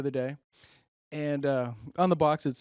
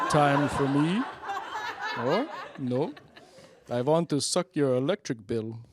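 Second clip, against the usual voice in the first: louder, laughing Transylvanian vampire tree; Transylvanian accent; 4 dB louder than usual. Pretend. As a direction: camp Transylvanian count, cracking up. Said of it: "My fake tree has a Transylvanian accent".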